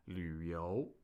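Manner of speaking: 旅游 low, then rising; third then second tone. Shown as a demonstration. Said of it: "旅 with a third tone and 游".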